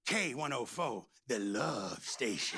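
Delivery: low, slow velvet radio voice; smooth radio DJ. Retold or imitated. Mimicking the old radio DJ, low and slow. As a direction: purr the station name like a DJ.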